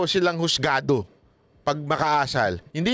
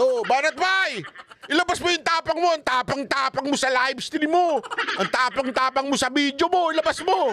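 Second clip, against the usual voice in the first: high, taunting mock yell; bully him with a dare; mock shouting congressman; 7 semitones higher than usual. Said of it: "ginanyan tayo". (pasigaw na panunuya)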